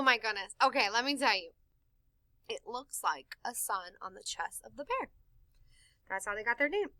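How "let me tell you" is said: ordinary delivery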